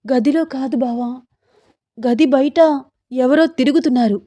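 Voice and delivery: high, anxious insistence; frightened wife. Acted. Sita tells her husband someone is prowling outside, frightened. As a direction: warn him in a worried voice. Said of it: "నాకు భయమేస్తోంది".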